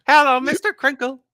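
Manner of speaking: high, loud put-on voice; song line imitation. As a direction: imitate a weird singer's high call.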